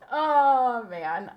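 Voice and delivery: ordinary talk, nothing marked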